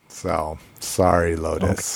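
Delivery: ordinary talk, nothing marked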